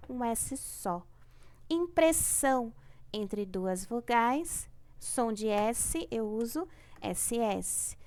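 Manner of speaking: ordinary talk, nothing marked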